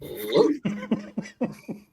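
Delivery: high-pitched burst of laughter; real laugh. Real, not acted. Host teases him about his picks, high amused laugh.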